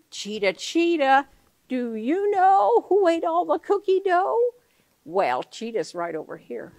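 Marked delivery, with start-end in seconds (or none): 0.1-4.5 high questioning call; mama puppet asking Cheetah; Mama's voice. Acted. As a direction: ask Cheetah brightly, up high.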